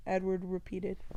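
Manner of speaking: ordinary delivery, nothing marked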